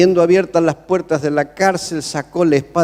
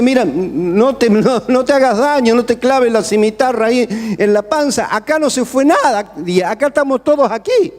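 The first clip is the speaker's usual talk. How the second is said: raised high, urgent call; Paul's shout; 6 semitones higher than usual. Retold or imitated. Paul shouting to the jailer not to kill himself. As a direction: call out to stop him from harming himself. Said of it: "Pablo lo grita y le dice".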